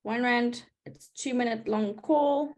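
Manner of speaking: usual speaking voice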